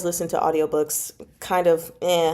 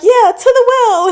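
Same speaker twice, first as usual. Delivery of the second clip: squealing high and loud, laughing; laughing fan chant; gleeful quote; 10 dB louder than usual. Retold or imitated. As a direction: cheer it like a meme chant. Fans chanting the meme back.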